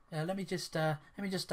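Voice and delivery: usual speaking voice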